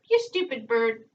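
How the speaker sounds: a talking bird copying the insult; parrot voice. A narrator's reading. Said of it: "said the parrot".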